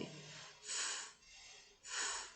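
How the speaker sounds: F hissed out, fading very soft; voiceless F demo. Shown as a demonstration. Teacher lets pupils feel no vibration on F. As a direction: blow the F with no voice in it. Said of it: "fala F".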